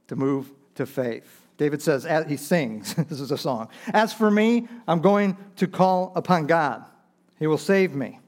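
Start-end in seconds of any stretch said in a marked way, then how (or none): 3.9-8.2 high, loud proclamation of trust; bold declaration. Retold or imitated. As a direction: proclaim it with raised, confident voice. Quoting David's bold declaration of faith.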